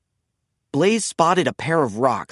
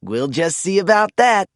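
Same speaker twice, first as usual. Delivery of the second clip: confident comeback; 3 semitones higher than usual. A narrator's reading. Blaze answers the rival.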